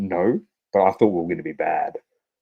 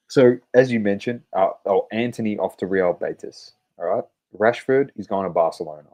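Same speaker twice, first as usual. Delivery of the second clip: usual speaking voice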